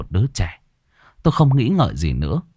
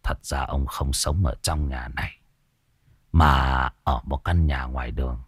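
put-on much lower and softer, a sorrowful old man; low sad voice; 6 semitones lower, 3 dB quieter than usual. A narrator's reading. Old man explaining the dead daughter's wish, low and sorrowful. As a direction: confess it heavily, grieving. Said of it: "cất giọng trầm buồn".

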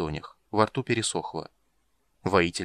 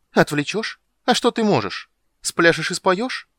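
much higher, louder scornful scoff; 10 semitones higher, 7 dB louder than usual. A narrator's reading. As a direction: ridicule the plan. Warrior scoffs at ghost's plan.

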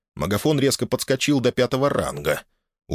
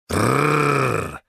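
angry growl; 4 semitones higher than usual. A narrator's reading. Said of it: "зло оскалился".

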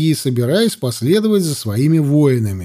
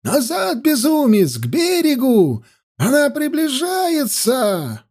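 high-pitched warning cry; frantic yelling; 9 semitones higher than usual. A narrator's reading. Soothsayer warns the swimming prince of danger, yelling. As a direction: cry the warning high, last words drawn out. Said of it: "вопил он".

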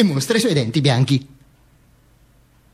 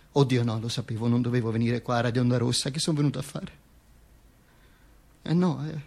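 soft, lower muttered aside; 6 semitones lower, 8 dB quieter than usual. Real, not acted. Performer steps aside from the story, softly. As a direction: drop out of the story, quietly. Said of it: "Oggi ho la faccia troppo da Mussolini".

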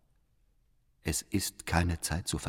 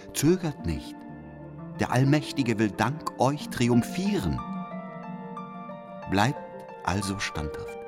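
raised pitch, swelling conviction; fervent exhortation; 5 semitones higher than usual. Acted. Letter writer urging Müntzer into open battle, fervent exhortation. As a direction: urge them on with rising fervour.

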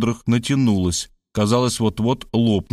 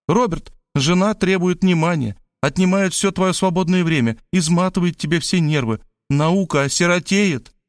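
higher, emphatic woman's voice; theatrical higher character voice, grave warning; warning Maria; 7 semitones higher than usual. A narrator's reading. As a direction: warn him earnestly, pitch held high. Maria warns Robert against marriage.

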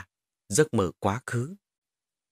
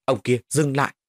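mildly raised commanding voice; yelled command; 3 semitones higher, 3 dB louder than usual. A narrator's reading. Boy ordering the attacker to stop, mildly yelled command. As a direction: order him to stop, a touch of a yell. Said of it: "Tôi quát lên".

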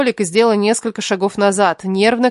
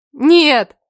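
loud, high emphatic denial; raised, insistent cry; exclaiming; 6 semitones higher, 5 dB louder than usual. A narrator's reading. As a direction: burst out with the denial. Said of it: "воскликнули мы одновременно".

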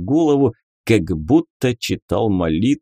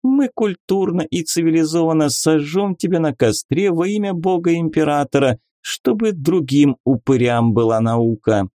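higher, teasing lady; lady inquisitor voice; 7 semitones higher than usual. Acted. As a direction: play a coy, mocking lady. Beatrisa, playful inquisitor lady. (игривая дама-инквизитор)

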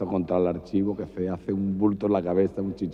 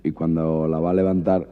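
usual speaking voice

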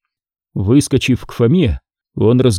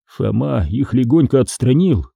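usual speaking voice

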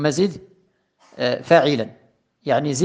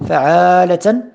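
فعالة said louder and higher, rising at the end; emphasized pattern; 4 semitones higher, 6 dB louder, slower than usual. Shown as a demonstration. Teacher stressing فعالة so students hear the alif. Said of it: "teacher stresses the pattern".

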